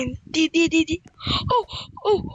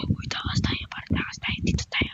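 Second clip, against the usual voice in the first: whisper; 6 dB quieter than usual. Acted. Escaping while sneaking.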